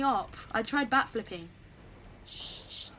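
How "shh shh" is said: softly whispered shh; whispered hush. Real, not acted. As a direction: hush someone quietly. Hushing mid-game.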